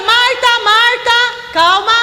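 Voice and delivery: high, slow, stretched name calls; calling her to calm down. Acted. Preacher imagining Jesus interrupting Marta, urging calm. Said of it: "Imagina se Jesus falasse assim".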